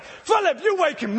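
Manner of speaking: bossy disciple. Retold or imitated. Disciples arguing who wakes Jesus.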